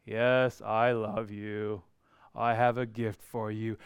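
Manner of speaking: low, soft, slow and flat; a dull, disinterested delivery; mock bored. Shown as a demonstration. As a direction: drone it without care. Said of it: "he's not coming along saying".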